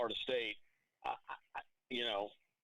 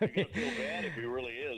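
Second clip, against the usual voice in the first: teasing; 11 semitones higher, 4 dB louder than usual. Real, not acted. Teasing co-host after dropped call.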